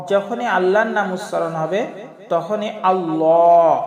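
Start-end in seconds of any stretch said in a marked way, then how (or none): 2.9-3.7 আল্লাহ with a thick dark l, slow and louder; heavy Allah. Shown as a demonstration. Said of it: "একটু মোটা করে আমাদের পাঠ করতে হবে".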